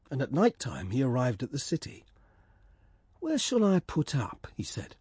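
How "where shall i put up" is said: lightly raised small bird wondering; swallow voice. Acted. Swallow arriving in the city, lightly raised. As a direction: ask it a bit higher, curious.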